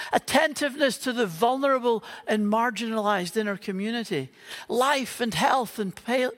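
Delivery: ordinary delivery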